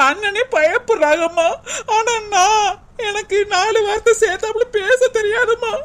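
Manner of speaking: anxious, high-pitched tearful complaint; rising into a weepy wail; tearful self-pity. Acted. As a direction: plead helplessly, close to sobbing. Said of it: "first அழுகையை நிறுத்துடி".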